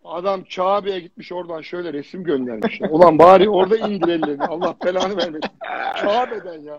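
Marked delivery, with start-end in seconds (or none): 2.9-6.8 mock scolding. Scolding the posing man.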